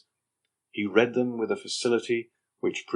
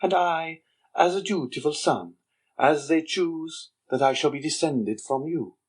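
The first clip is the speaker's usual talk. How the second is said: put-on sly young Andrea; 6 semitones higher than usual. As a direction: needle the Major with sly contempt. Andrea plays the dutiful son.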